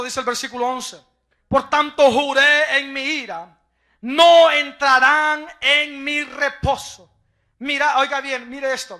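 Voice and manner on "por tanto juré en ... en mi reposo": slow, loud, weighty recital; solemn scripture reading. Acted. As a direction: proclaim God's oath slowly. (lectura solemne y lenta de la Escritura)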